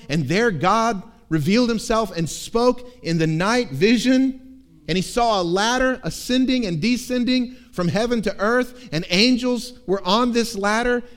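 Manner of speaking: preacher building to a big moment; building sermon climax. Acted. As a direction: swell the voice toward heaven. Preaching builds to loud vision.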